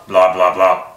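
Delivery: low chattering head answering back; talking-head puppet. Shown as a demonstration. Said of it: "two talking heads going blah".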